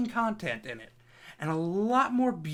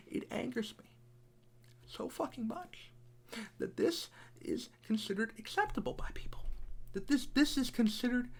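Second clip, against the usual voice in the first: much softer, near-whispered anger; quiet seething; 5 semitones higher, 8 dB quieter than usual. Real, not acted.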